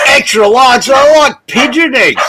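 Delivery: high loud mock astonishment; mock surprise. Real, not acted. Host mocking "extra large" tiny eggs, high and loud. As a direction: scoff loudly, high and amazed.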